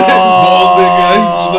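laughing loudly through the words; cracking up while describing him. Real, not acted. Friends laugh at the story, loud laughing.

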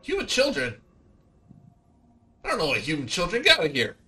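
high, irritated creature voice; grumpy little dragon. Acted. Meepo snaps at the humans asking about children, high and cranky. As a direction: brush them off, cranky and small.

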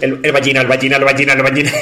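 rapid-fire repeated name; said fast. Shown as a demonstration. Comedian running the pun name together on a dare, fast. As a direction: rattle the name off over and over. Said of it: "Dilo rápido".

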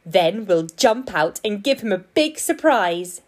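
ordinary delivery

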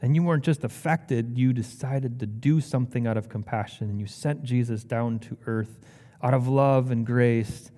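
hushed, unhurried praying voice; sincere prayer. Real, not acted. Preacher thanking God for Lazarus's story, prayerful. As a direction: speak humbly to God, pausing between thoughts.

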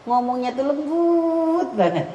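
high, slow, drawn-out lilt; sinden-like soft speech. Retold or imitated. Teacher imitates a soft-spoken child, sinden-like lilt. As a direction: draw it out gently like a sinden singer. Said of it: "kalau ngomong tuh kayak orang sindenan".

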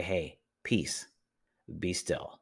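soft, hushed reassurance; calm a frightened friend, softly; gentle Jesus. Retold or imitated. Jesus calming a worried listener, soft and gentle. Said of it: "He's gonna say".

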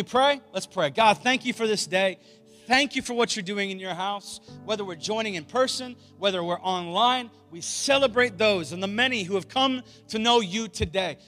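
loud, high preaching prayer; rally speech. Real, not acted. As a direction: pray it like a battle cry. Closing prayer at full force.

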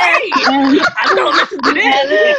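slow, high, laughing objection; laughing protest. Real, not acted. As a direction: laugh it off in mock shock. Laughing protest at raunchy intro.

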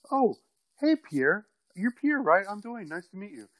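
high, bright friendly greeting; sudden cheerful politeness. Retold or imitated. Greeting Pierre mid-fight, suddenly sweet and friendly. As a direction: switch instantly to polite small talk.

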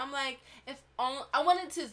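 ordinary talk

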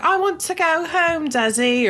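high mock child's voice; little British girl. Podcast host mimics the film's British child, high-pitched. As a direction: lightly voice a small British girl. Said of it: "She's like".

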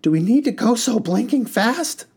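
high, loud worried cry; storyteller crying out over the engine; cried out. Acted. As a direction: call out to the speeding driver. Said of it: "cried out".

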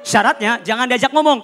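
ordinary talk

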